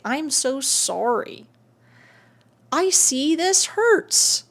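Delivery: slow and gentle, soothing a hurt part; tender comfort. Pretend. Coach showing viewers how to soothe their hurt self, slow and tender. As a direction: comfort a wounded inner child. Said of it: "hold this part of yourself and say".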